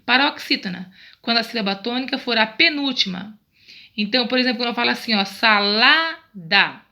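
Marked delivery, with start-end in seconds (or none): 5.2-6.8 "salada" very slow, middle "la" stretched and strong; teacher sounding out "salada" by syllable, weight on "la"; stress on LA. Shown as a demonstration. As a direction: draw out sa-LA-da, pushing the "la". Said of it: "lá é a sílaba mais forte".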